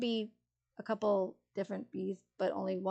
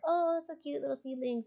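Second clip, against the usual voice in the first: higher, doting little coo; cooing at seedlings; 6 semitones higher than usual. Retold or imitated. Gardener cooing over first seedlings, higher and tender. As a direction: gush over tiny sprouts like babies. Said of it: "and I'm like".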